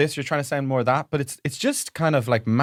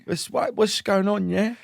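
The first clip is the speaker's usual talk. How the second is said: high, slow, slurred English lad; mock an English rich kid acting street; posh English boy faking street accent; 8 semitones higher, slower than usual. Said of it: "a lot of English boys".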